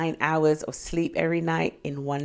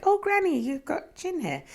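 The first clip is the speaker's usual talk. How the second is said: put-on high, childlike "Oh, granny" then trailing off soft; teasing child voice; 11 semitones higher, 5 dB quieter than usual. To her granny as a child, high teasing squeak. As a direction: tease granny in a squeaky little voice. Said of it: "and I was like".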